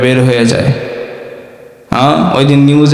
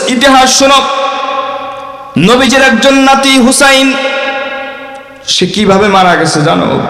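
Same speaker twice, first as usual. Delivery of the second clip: high mock lecturing voice; lecturing pir; 10 semitones higher than usual. Pir lectures the disciple on history, high and mocking. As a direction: lecture him like a pompous pir. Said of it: "এবার পীর বাবা বলতেছে".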